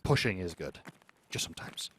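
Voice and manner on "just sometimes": breathy whispered aside. Shown as a demonstration. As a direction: whisper it to chat like a secret. Whispered repeat of own line.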